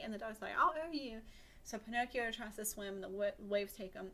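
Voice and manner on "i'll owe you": pleading promise. Acted. Dog bargains for rescue.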